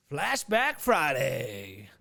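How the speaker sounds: drawn-out show-segment announcement; segment announcer. Shown as a demonstration. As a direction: stretch it out like a jingle intro.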